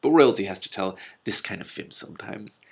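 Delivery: usual speaking voice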